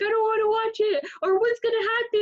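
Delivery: high, whiny mock fear; mock scared. She imitates herself scared of a movie, high and whiny. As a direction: squeal like a scared viewer.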